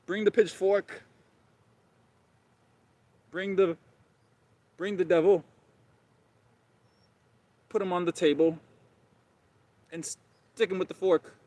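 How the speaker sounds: high, put-on king voice giving orders; imperious high king voice; mock royal decree. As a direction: play a pompous king commanding his servants. King ordering the devil's grilling.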